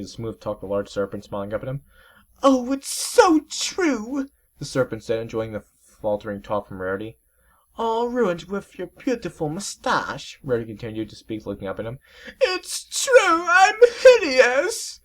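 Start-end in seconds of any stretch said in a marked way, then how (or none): 2.4-4.3 very high, slow falsetto whine; a vain sea serpent sulking over his lost mustache; high, put-on whimpering voice; weepy dandy serpent. Serpent agrees. 7.8-10.4 very high, slow refined lady voice; drawn-out fashionable lady; high, affected voice; prissy Rarity. Acted. Rarity flatters serpent. 12.4-15.0 very high, loud crying falsetto, slowing down; over-the-top weeping serpent; hammed-up sobbing. Serpent weeps.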